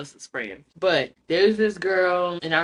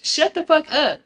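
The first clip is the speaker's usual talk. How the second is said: higher and louder, shut the fuck up; fed-up command; exasperated scold; 9 semitones higher, 4 dB louder than usual. Real, not acted. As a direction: snap at the oversharer. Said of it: "scolding the reader".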